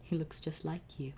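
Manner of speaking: soft, hushed lullaby tone. Acted. Akunra softly telling his husband about their baby, lullaby voice. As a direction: coo it softly over the sleeping baby. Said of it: "in a lullaby-like voice".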